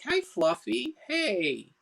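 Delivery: put-on high, happy child voice; little boy. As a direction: greet a beloved toy like a small boy. Small boy greets his stuffed dog.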